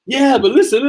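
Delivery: high-pitched, giddy re-enactment; laughing excitement. Retold or imitated. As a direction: brush off the objection with gleeful eagerness. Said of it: "Oh, and I'm laughing".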